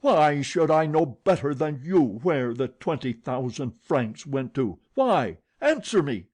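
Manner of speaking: loud growling roar like thunder. A narrator's reading. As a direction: roll it out like thunder. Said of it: "he roared".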